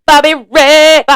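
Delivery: loud, high greeting; calling out joyfully to a viewer. Real, not acted. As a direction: hail a friend across the room.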